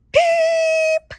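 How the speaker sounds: long, piercing, loud bird peep; highest, most annoying chirp. A narrator's reading. Boy putting heart and soul into it, piercing chirp. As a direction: squeal out an ear-splitting peep. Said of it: "the highest and most annoying chirp of all".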